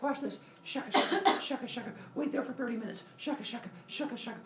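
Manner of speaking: high-pitched playful prankster voice; hammed-up show-off; mock prankster. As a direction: put on a high voice for a practical joke. Voicing pranksters showing off to friend.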